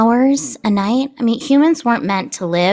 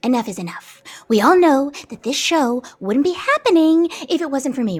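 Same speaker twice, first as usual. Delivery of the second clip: normal talking voice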